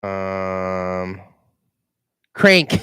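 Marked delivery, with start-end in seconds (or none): 2.3-2.8 loud burst of recall, then laughing; shouting the title as it comes back; eureka shout. Real, not acted. Vlogger suddenly recalls the movie title, loud and laughing. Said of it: "remembers movie title".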